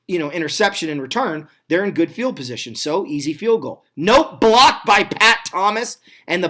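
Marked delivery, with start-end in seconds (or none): 4.0-6.0 louder, drawn-out surprise at the block; excited call. Real, not acted. Commentator reacting to a blocked kick, excited.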